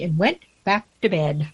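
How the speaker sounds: measured, lilting storybook verse; poem recitation. Acted. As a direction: read the rhyme theatrically, giving each character a voice. Reads a poem aloud.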